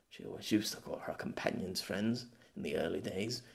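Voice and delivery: soft unvoiced whisper; whispered aside. Pierre recalling Ardig to himself, whispered. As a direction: mutter it half to himself.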